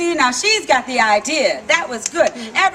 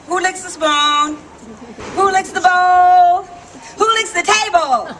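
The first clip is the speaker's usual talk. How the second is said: each question louder and higher, building to a laugh; playful rising questions; 7 semitones higher, 6 dB louder than usual. Real, not acted. Teasing the audience about licking the spoon, building up excited. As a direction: tease the crowd, building up with every question.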